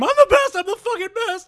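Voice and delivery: very high, loud boastful scream; imitated fighter's triumphant shriek; mock victory scream. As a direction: scream it like a fighter crowing from the cage top. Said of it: "jump to the top of the cage and scream".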